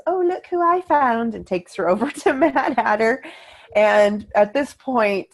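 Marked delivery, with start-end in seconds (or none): 0.1-1.4 high, sing-song storybook princess; imitating a cheerful park character, much higher; sweet Alice voice. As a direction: voice a Disney Alice greeting a child.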